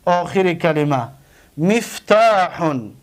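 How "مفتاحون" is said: مفتاحون said slowly, each syllable clear; teacher modelling مفتاحون; careful slow recital of مفتاحون; slow pronunciation. Shown as a demonstration. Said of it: "دیدید؟".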